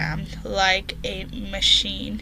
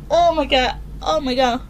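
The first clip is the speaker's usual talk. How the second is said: ordinary delivery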